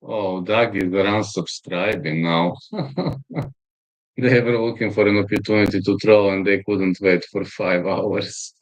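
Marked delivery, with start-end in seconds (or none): 2.6-3.5 short chuckling laugh; real chuckle, higher than the talk. Real, not acted. Amused at Doug unsubscribing, laughing.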